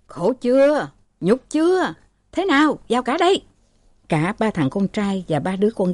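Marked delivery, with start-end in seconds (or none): 0.1-3.4 high, pained cry of shame; sick father crying out at his brawling sons; anguished outburst. Acted. Father despairs at fighting sons.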